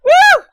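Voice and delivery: very loud, high "woo"; real victory whoop; whooping cheer. Real, not acted. Player celebrating full damage on the boss, loud whoop.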